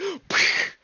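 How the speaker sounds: high, loud, airless laughing fit; breathy wheeze laugh. Real, not acted. Keeps laughing at wings.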